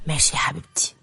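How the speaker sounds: whispered, tender agreement; soft whisper. Acted. Omar agreeing to let Roaya stay, whispered. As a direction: breathe it out softly. Husband agrees to her staying.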